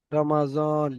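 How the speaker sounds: "রমাজন" with a buzzed Z in the last syllable; Urdu pronunciation. Shown as a demonstration. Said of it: "ওনারা, উর্দু". (উর্দু উচ্চারণে "রমাজন")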